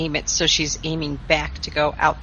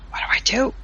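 whispered; 6 semitones higher than usual. Acted. Mary Sue shrugs, whispering to Michael.

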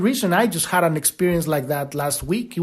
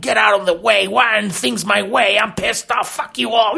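raised, ranting caricature of a pushy person; mock pushy person; 4 semitones higher than usual. Mocking the pushy who shove others aside, ranting. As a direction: bluster it like a petulant bully. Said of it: "being expressed as pushing around".

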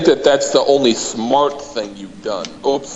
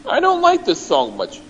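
usual speaking voice

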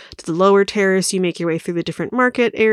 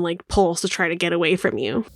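normal talking voice

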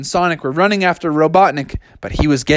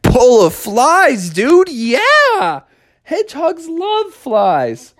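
thrilled Sonic; 9 semitones higher, much slower than usual. Acted. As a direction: savour the treat.

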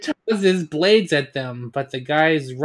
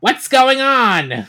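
alarmed queen; 7 semitones higher, 5 dB louder, slower than usual. Acted.

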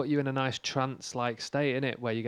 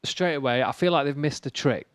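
normal talking voice

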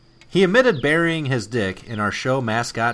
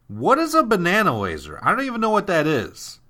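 higher pitch, puzzled outrage; indignant troll; 8 semitones higher than usual. Acted. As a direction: read it as baffled and offended. Letter reading, original take.

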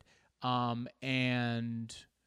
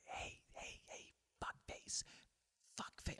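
barely voiced, hushed name-calling; whispered insult; 16 dB quieter than usual. Driver insulting someone in another car, whispered. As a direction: whisper the insult as if nobody can hear. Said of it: "you, you whisper".

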